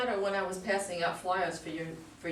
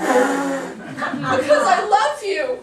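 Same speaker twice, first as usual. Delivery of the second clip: loud, drawn-out, slow cry of love; hammed-up love confession; 7 semitones higher, 14 dB louder, much slower than usual. Tammy confessing her love to her boss, loud and slow. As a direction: blurt it out through a laughing sob, very slowly.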